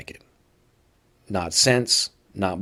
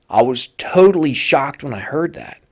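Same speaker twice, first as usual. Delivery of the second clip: ordinary talk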